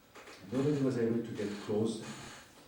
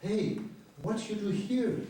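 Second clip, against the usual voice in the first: higher, surprised call to a stranger; startled call; 9 semitones higher, slower than usual. Retold or imitated. Photographer calling to a girl on the street, surprised. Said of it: "and I'm going to her".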